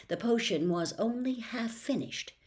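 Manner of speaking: plain reading voice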